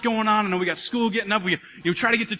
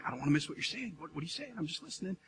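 much softer, lower, waiting; hushed attentive; 3 semitones lower, 14 dB quieter, faster than usual. Retold or imitated. Husband to wife, hushed and attentive. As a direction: breathe it out, all ears. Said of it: "I'm like".

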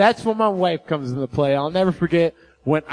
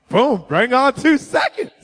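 raised, cocky challenge; mock bravado; 6 semitones higher than usual. Pastor boasting to the doctor, cocky mock bravado. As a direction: brag like a tough guy.

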